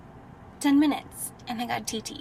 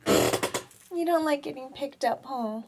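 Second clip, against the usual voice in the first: talking to a dog; 5 semitones higher, slower than usual. Real, not acted. Cooing to the puppy.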